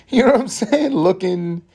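high-pitched words pushed out through laughter; real laughing. Real, not acted. Podcaster tickled by his own description, laughing. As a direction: still cracking up while picking the thought back up.

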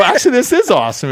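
high, loud mock amazement; amazed admission. Filmmaker admitting it to the financier on set, high amazed exclamation. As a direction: pitch leaping up in astonished delight.

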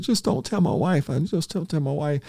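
very high, whiny mock sobbing plea; mock crying. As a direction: beg in a squeaky fake cry. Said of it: "And he started crying".

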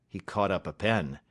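ordinary delivery

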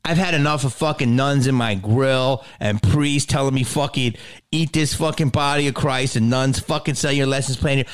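usual speaking voice

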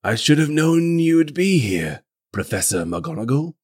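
a hint of a British accent, badly faked; attempted British accent. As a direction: try a Dumbledore British accent. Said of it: "I can't do a British accent".

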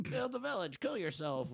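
very soft, high start falling away; hushed village voice. Shown as a demonstration. Correcting the village line, hushed small voice. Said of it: "It's more like the".

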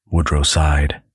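normal talking voice

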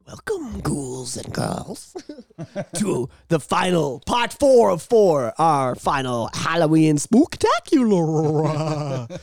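slow, drawn-out horror host. Acted. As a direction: welcome them like a creepy host. We're a little hyper tonight.

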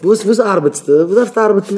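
high, loud questioning; mock challenge. Challenging a man about why he works, loud and high.

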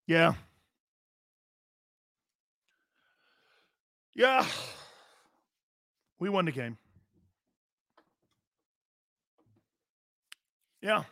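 lazy, half-hearted yeah; Baker Mayfield impression. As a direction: mumble it like a bored quarterback after a win. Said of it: "let me do my impression of Baker Mayfield".

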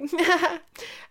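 high, short put-on laugh; demo fake laugh. Shown as a demonstration. Podcaster shows the laugh friends tease, fake laugh. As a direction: demonstrate a laugh that rings false. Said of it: "that laugh where I'm just like".

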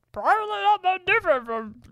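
high, loud put-on troll voice that breaks into a laugh; female troll voice. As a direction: squeak out a female troll, then crack up. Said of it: "Oh my gosh, what was that?".

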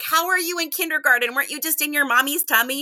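put-on high, bright teasing disbelief to a child; playful surprised teacher; mock astonishment. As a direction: act amazed at the kindergartner. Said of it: "and I'm like".

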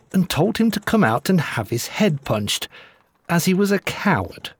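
ordinary delivery

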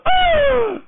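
flamboyant high loud gasp; a scandalized, theatrical gasp; over-the-top shocked gasp. Retold or imitated.

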